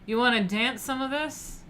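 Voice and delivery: lightly voiced mafioso; mafioso come-on. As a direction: hit on her like a mobster. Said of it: "the mafioso way of asking".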